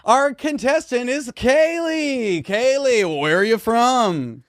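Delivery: over-the-top game show host, high and drawn out. Acted. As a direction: sell it like a cheesy TV host. Hosting a mock game show.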